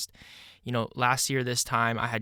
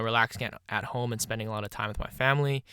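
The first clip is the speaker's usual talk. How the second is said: ordinary delivery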